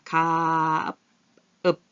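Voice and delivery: 가 held very long, then closed with 읍; drawn-out 가 with 읍 attached; sound-blending demo. Shown as a demonstration. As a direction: stretch "가아아" slowly, then stop it with "읍". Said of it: "여기에 붙었다 그랬죠".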